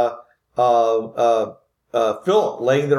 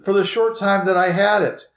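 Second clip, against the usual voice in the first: high, pleading female character voice; apologetic lady; 8 semitones higher than usual. Acted. Lady asks Philip to forgive her, high pleading voice. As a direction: beg pardon in a high, ladylike voice. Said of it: "you hear this female voice".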